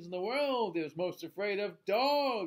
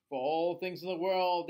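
plain reading voice

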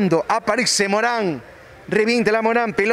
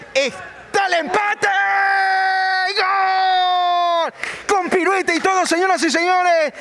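excited goal call; 7 semitones higher, slower than usual. Real, not acted. Goal called in live commentary.

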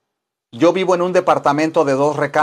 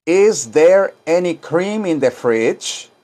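slow, careful English; slow teaching read; slow model sentence; 2 semitones higher, slower than usual. Shown as a demonstration. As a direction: say it slowly for learners to repeat. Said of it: "Digan esto".

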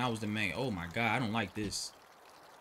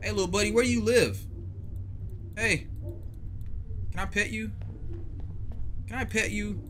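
pet talk; 8 semitones higher than usual. Real, not acted. Talking to a stray dog.

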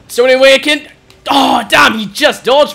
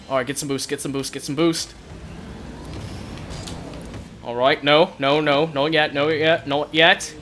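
low, soft self-coaching; hushed, low-pitched repetition; focused muttering; 8 semitones lower, 11 dB quieter than usual. Real, not acted. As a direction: murmur to yourself while concentrating. Muttering to self while concentrating.

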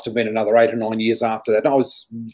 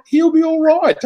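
he'll be all right much higher and louder; higher reassurance; 4 dB louder than usual. Retold or imitated. Grandfather soothing screaming mum, pitched much higher. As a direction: lift it up high to soothe.

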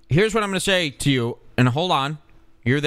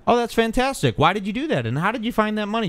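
delighted; 7 semitones higher than usual. Real, not acted. Thrilled by the caller's donation.

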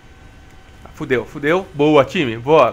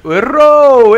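loud exclamation; 6 semitones higher, 9 dB louder than usual. Real, not acted. Reacting to a miss.

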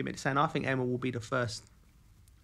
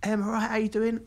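higher, louder bright hello; cheery greeting; 8 semitones higher, 4 dB louder than usual. Retold or imitated. Joe bumping into Emma at lunch, higher cheery hello. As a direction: greet her like a pleased old friend.

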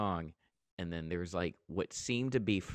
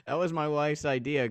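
laughing while speaking; 9 semitones higher, 7 dB louder than usual. Real, not acted. Laughing at the title joke.

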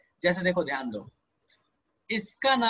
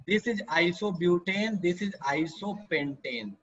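slow labelling; much slower than usual. Shown as a demonstration. Teacher labels structures slowly.

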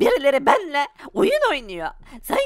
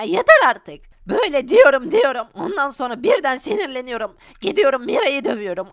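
ordinary delivery

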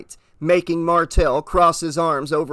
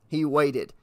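ordinary delivery

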